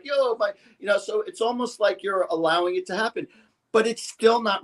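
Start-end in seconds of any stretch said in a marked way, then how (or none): 0.0-0.5 high, breezy "Yo, my"; casual street greeting. Retold or imitated. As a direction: toss it off like a buddy. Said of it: "It's like".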